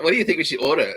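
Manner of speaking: baiting. Retold or imitated. Mock-innocent question at party.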